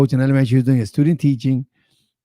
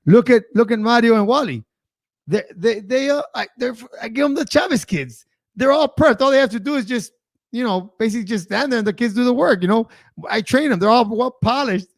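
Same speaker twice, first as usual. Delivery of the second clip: retold ribbing, pitched higher and bragging; playful teasing; 8 semitones higher than usual. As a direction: needle the coach with mock pride. Teasing the coach at the polls.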